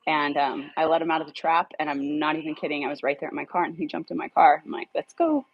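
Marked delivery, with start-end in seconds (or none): 5.0-5.5 high, bright call to the dog; happily calling the rescued dog into the car; delighted. Retold or imitated. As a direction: cheerfully welcome the dog aboard, much higher. Dog jumps in her car.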